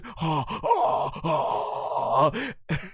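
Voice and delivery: repeated gasps, the last drawn out; mock shocked gasps. Vegeta in close-up, mock devastated gasps. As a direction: gasp as if utterly devastated.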